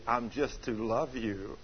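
hushed divine quote; God's quoted words. Retold or imitated. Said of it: "he told me audibly".

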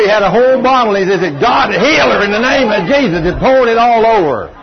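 loud, high, driving revival delivery; full-force preaching. Retold or imitated. Preacher retells a healing prayer to the congregation, full-force preaching. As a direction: belt it out like a healing evangelist.

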